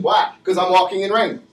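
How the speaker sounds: normal talking voice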